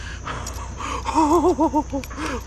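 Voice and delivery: high giddy laugh of disbelief; delighted laugh. Real, not acted. Angler laughing at the giant fish he just hooked.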